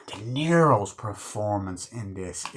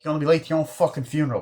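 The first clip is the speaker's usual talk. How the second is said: fast mocking jab; 5 semitones higher, 3 dB louder, much faster than usual. Pesci mocking Stax.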